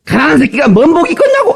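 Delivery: high, indignant mock outburst; 강의하는 양반 흉내. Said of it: "그 양반이 그러더라고요". (높은 목소리로 따지듯 흉내)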